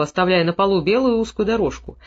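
plain reading voice

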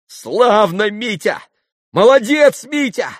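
high, loud, malicious cheer; spiteful cry. A narrator's reading. Grushenka applauds Mitya, shouting spitefully. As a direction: cheer him on with a vicious ring. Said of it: "страшно злобная нотка прозвенела в её восклицании".

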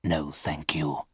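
low, slow, soft refusal; lightly voiced ghost. A narrator's reading. Ghost declining Virginia's sandwich, soft low voice. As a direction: decline softly and slowly. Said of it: "he replied".

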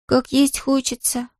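slow, high-pitched plaintive sigh; wistful, slow child's sigh; sighing complaint. A narrator's reading. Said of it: "вздохнула Мона".

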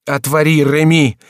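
high, loud bellow; frantic shouted demand; roaring. A narrator's reading. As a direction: roar an order through the door. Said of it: "страшно заревел молодой человек".

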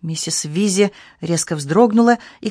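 usual speaking voice

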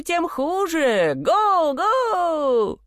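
very high, stretched halloo; calling out. A narrator's reading. Simpleton hails brothers yet again, calling out. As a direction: let the words soar and hang in the air. Ганс снова кличет братьев.

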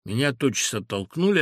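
plain reading voice

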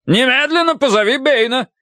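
high, peremptory shout; furious order. A narrator's reading. Colonel orders frightened maid to fetch the butler, angry shout. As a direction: demand it at once, pitched right up.

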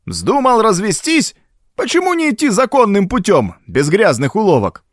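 put-on high, indignant old man's rant; hot-tempered outburst. A narrator's reading. Old Baines accuses Gordon, ranting high and angry. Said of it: "опять вспылил Бэйнс".

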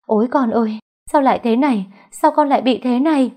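high, loud wailing lament; a mother crying over her sick daughter. A narrator's reading. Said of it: "gào khóc".

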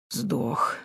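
quiet gurgle from a dead throat; gurgling corpse voice; gurgling alien voice. A narrator's reading. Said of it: "забулькало в горле мертвеца".

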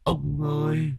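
soft, slow wronged ghost; ghostly wail. Acted. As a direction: plead like a restless ghost. Ghost pleads with master.

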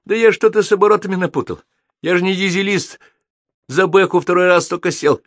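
high, pleading mechanic voice; whining excuse. A narrator's reading. Hapless mechanic excusing himself to the shouting captain, high and whiny. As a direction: make excuses in a squeaky plea.

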